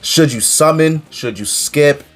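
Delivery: ordinary delivery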